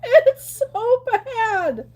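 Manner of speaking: slow, high, laugh-cry through the words. Real, not acted. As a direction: wail it while laughing. Laughing at streamer's chat.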